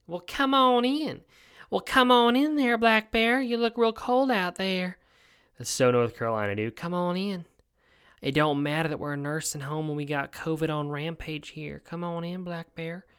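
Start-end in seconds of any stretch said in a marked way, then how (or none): 0.1-5.0 high, slow, falsetto Southern drawl welcoming a bear; mock nursing home worker. 6.8-13.1 higher folksy voice inviting the bear in; lilting high caretaker impression trailing off; mock nursing home worker. Worker voice continued.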